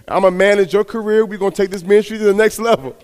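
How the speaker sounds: wife announcing she quits too, loud and eager; excited wife. Retold or imitated. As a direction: burst out with her big decision. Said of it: "She said".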